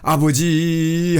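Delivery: slow, drawn-out call of 아버지; a long earnest plea; calling on the Father. Shown as a demonstration. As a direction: cry out to God as Father. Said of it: "하고 기도하고".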